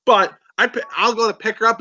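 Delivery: ordinary talk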